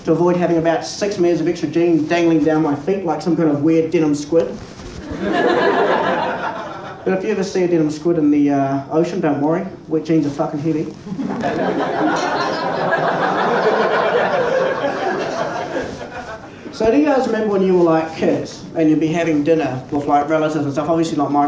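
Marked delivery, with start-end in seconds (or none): 4.5-7.0 high airy silent laugh; real breathless laugh. Real, not acted. Laughs at own joke. 10.9-16.7 long airy wheezing laugh; can't stop laughing; real long wheeze. Real, not acted. Laughs at own joke.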